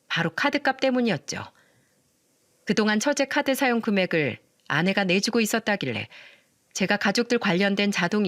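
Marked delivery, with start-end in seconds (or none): none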